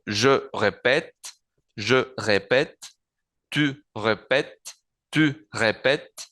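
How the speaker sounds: répète slow, ray-pet, grave è open; each form twice, répète with an open è; slow conjugation drill. Shown as a demonstration. Teacher slowly drills répéter, each form said twice. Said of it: "Entonces, digo".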